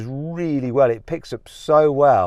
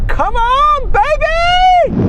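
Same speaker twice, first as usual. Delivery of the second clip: real thrilled whoops, far higher and louder; excited yelling; 3 dB louder, much slower than usual. Real, not acted. Driver cheering his car on during the rolling race, thrilled whoops. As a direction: cheer the car on at full throttle, high and loud.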